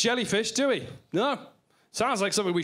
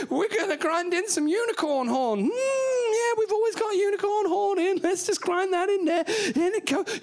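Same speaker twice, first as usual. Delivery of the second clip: very high, gushing cookery presenter; hammed-up high TV cook voice; chirpy TV chef; 10 semitones higher than usual. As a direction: parody an over-keen TV chef, pitched way up. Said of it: "they're always there going".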